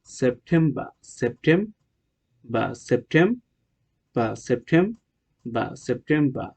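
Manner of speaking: সেপ্টেম্বর ending in "bah", no r; British pronunciation. Shown as a demonstration. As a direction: say সেপ্টেম্বর as "sep-tem-bah", syllables stretched. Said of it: "British উচ্চারণ হলো". (সেপ্টেম্বর, শেষের র ছাড়া British উচ্চারণে)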